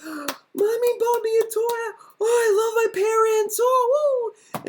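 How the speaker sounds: very high, drawn-out mock squeal; happy kid. Kid whose parents bought a toy, mock happy squeal. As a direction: gush like a delighted little child. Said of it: "happy because you're not angry anymore".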